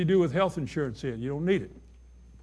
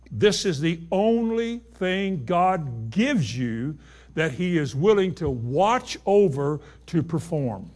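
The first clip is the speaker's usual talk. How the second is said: usual speaking voice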